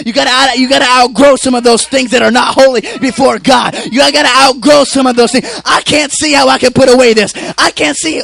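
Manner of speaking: loud, high, sustained sermon shout; full-force preaching. Acted. Preacher urging the church to outgrow worldly things, full-force preaching. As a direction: drive every phrase home at full voice.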